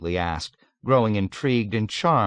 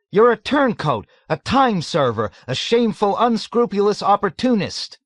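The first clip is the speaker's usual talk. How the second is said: much higher, louder outraged accusation; crying out in disbelief; 13 semitones higher, 6 dB louder than usual. A narrator's reading. Nately accuses the old man, crying out in disbelief. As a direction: hurl the insults, appalled. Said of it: "cried out in disbelief".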